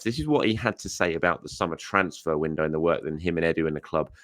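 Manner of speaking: usual speaking voice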